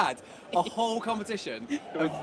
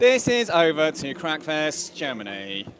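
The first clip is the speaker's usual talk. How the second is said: slow, louder pretend broadcast line; mock commentator; 7 dB louder, slower than usual. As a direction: hand over like a TV presenter, hammed up. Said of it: "Maybe hold your nose like that".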